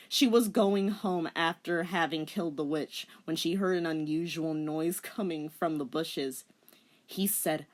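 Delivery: ordinary delivery, nothing marked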